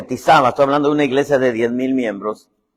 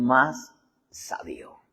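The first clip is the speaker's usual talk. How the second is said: slow whisper; slow reverent whisper; hushed scripture; 2 semitones lower, 9 dB quieter, much slower than usual. Real, not acted. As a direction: lean in and whisper the proverb. Scripture line whispered for effect.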